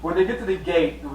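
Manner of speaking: normal talking voice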